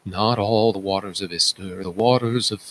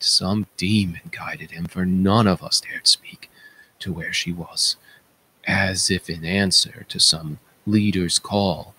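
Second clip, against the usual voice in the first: ordinary delivery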